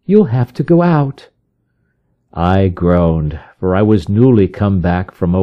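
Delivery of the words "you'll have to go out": lightly raised voice of the wife; a hint of the wife, gently prompting; wife announcing a patient, lightly voiced; wife's voice. A narrator's reading. Wife speaks to husband.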